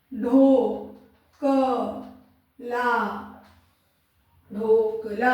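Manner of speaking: ढोकला very slowly, each syllable held apart. Shown as a demonstration. Teacher dictating to pupils, ढोकला broken into dho, ka, laa. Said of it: "अगर बोले ढोकला लिखो".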